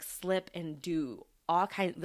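ordinary talk

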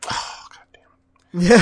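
slow, hushed curse; whispered mock exasperation. Tempted man at the goat offer, reluctant whisper.

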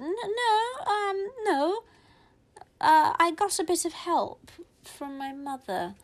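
high, hesitant fib; nervous stammer. Retold or imitated. Big sister caught out by the teacher, nervous stammer. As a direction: stall while making up an excuse.

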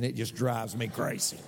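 normal talking voice

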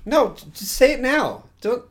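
high, loud protest. Real, not acted. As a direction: plead for it now. Protests the teaser.

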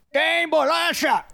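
high, slow put-on voice; squeaky slow call offering a biscuit; silly exorcist voice. Shown as a demonstration. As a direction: coax a demon like a goofy stranger. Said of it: "eu posso fazer assim".

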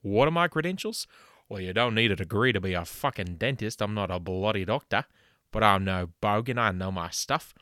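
loud, brash Aussie ad-man voice; hammed-up Aussie huckster selling dental work; fake ad pitchman. Host doing a spoof sponsor ad, loud Aussie pitchman. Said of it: "a word from our sponsors".

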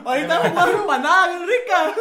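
loud, high mock Veracruz accent; laughing coastal Veracruz impression; costeño accent. Shown as a demonstration. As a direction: put on a broad costeño accent, amused. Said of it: "Ese es el acento costeño por excelencia".

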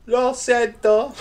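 high, slow mocking echo; mock apology. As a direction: drag out the apology, squeaky and high.

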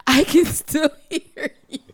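loud, high, breaking into laughter; mock complaint lost in laughter; laughing retort. Drinker to the man who moved away, cracking up.

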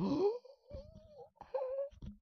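high, sharp gasp of disbelief; shocked gasp. Retold or imitated. Viewer gasps at Charlie's prize, shocked. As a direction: gasp like you can't believe it. Reacting to the factory gift.

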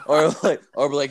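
usual speaking voice

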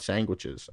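"sandwiches" said as "sangwiches"; quoted mispronunciation. Retold or imitated. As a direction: put ng into "sandwiches": sang-wiches. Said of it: "Dane Cook does it all the time".

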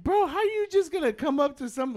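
high-pitched "bro, how you just gonna" in mock outrage; incredulous scolding. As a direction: call her out in disbelief. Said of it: "I was like".

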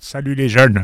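high, loud put-on little voice. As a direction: greet the young ones in a squeaky little voice. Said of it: "je vais prendre une petite voix".

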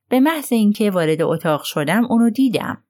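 ordinary delivery

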